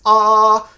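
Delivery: a held open "ah" to show the formant; open ah vowel. Shown as a demonstration. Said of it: "if I do like this".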